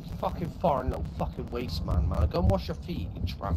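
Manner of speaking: muttered insults. Real, not acted. Gamer grumbling about a teammate, quiet contemptuous muttering. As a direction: mutter the insults under your breath.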